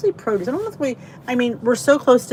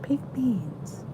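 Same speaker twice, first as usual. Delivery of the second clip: whisper; 3 semitones higher, 6 dB quieter than usual. Real, not acted. Repeating a comment's food.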